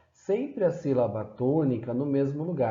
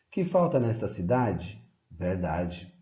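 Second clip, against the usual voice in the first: question, then a dropped one-word answer; ask, then answer low; poem recital; 3 semitones lower than usual. Acted. Teacher reciting a satirical poem, question and answer.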